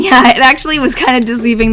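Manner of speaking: ordinary talk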